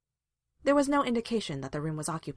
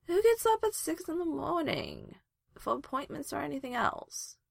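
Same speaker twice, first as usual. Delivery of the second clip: mush-mouthed with sleep; 10 semitones higher than usual. A narrator's reading. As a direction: whine it through an enormous yawn. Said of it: "She was mush-mouthed with sleep".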